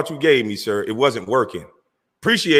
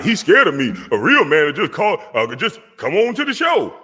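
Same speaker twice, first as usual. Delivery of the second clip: mocking rival's taunt; 6 semitones higher than usual. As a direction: mimic the rival's tough-guy challenge. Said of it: "Telling everybody".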